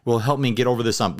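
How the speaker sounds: ordinary delivery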